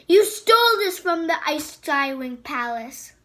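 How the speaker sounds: loud, accusing queen; furious loud accusation; angry accusation. Acted. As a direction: throw the charge at him.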